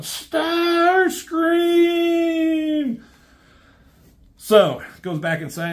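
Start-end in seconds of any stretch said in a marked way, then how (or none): 0.0-3.0 long, high, drawn-out scream of the name; Megatron's scream. Retold or imitated. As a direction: scream the traitor's name as you fall into space. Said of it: "Megatron screams out".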